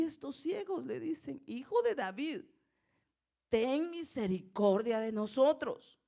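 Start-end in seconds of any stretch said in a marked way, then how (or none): 1.5-6.0 higher, louder demonstrated plea; restrained crying out. Shown as a demonstration. Blind men crying after Jesus, half-held shout. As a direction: call out for mercy, holding back from a full shout. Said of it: "No le grito porque si no me bajan el volumen".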